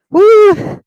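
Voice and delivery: high, loud woo; excited whoop. Real, not acted. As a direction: push through with a whoop. Cheering through the strain.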